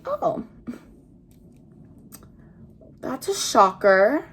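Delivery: high oh, then slow dry sarcasm; mock surprise, drawn out; sarcastic. Teen mocks the friends' news, sarcastic fake shock. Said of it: "I'm like".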